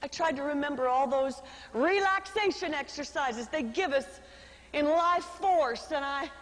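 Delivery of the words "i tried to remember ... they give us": ordinary delivery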